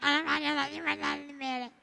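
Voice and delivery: put-on soft sobbing little child's voice; a kid crying out a name; tearful child. Shown as a demonstration. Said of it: "Choro da criança que achou".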